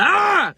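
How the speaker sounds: short, high, loud mock yell; imitated scream. Man shows how he screams while cooking, mock scream. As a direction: reenact your own sudden burst of screaming. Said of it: "I literally just start screaming".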